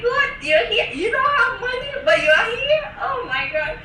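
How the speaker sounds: high, laughing mock disbelief; incredulous staff. School staff to broke student, high mock disbelief. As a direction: play the school staff, baffled she came without money. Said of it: "They looked at me like".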